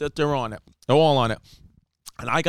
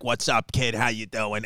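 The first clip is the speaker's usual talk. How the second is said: lightly voiced low, quiet tough-guy greeting; casual mock gangster hello, pitched down; a hint of a Boston wiseguy; Boston criminals; 6 semitones lower, 4 dB quieter than usual. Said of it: "'cause they're like".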